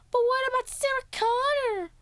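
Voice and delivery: very high squeaky acted voice; squeaky imitation of Danny; mock worried plea. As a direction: whine it up high in pretend distress. Voicing Danny pleading.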